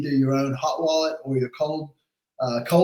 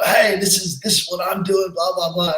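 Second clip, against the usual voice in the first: brash American pitch; 3 semitones higher than usual. Retold or imitated. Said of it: "that American gumption".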